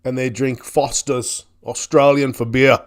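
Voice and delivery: slow, badly faked Australian ad slogan; hammy attempt at an Australian accent; terrible Australian accent. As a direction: stretch out a mock Aussie accent. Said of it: "I know my accent's terrible".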